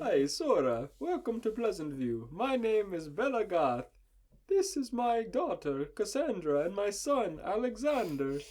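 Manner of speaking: high, sing-song mock Italian accent; high-pitched exaggerated Italian-accented introduction; hammed-up Italian accent. A narrator's reading. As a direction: redo it in a broad, drawn-out Italian accent. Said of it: "She said in some form of Italian".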